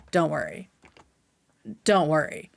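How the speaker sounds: usual speaking voice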